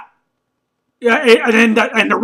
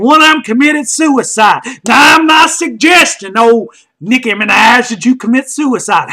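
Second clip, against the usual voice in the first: ordinary delivery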